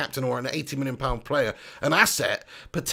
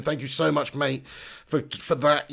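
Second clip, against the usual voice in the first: ordinary talk, nothing marked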